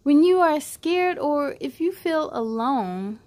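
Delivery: put-on reading to children. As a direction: read it out like a bedtime story for little ones.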